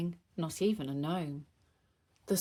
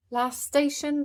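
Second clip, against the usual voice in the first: conductor call; 7 semitones higher, 4 dB louder than usual. Acted. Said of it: "the driver did shout".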